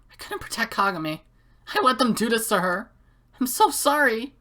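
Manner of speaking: put-on girl character growing desperate; girl apology building. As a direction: build from hushed to pleading.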